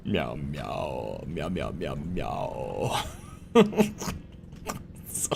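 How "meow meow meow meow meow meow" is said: deep, soft meowing; low cat meows. Pretend.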